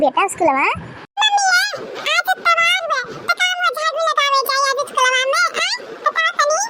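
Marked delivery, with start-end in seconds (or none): 1.2-5.7 put-on child voice. Son answers mother about school. 6.0-6.6 put-on child voice. Son answers mother.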